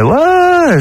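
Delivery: high, drawn-out quoted yeah; acted assurance. Magician to hitchhiker in a joke, acted assurance. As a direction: swoop up high as the storyteller's character.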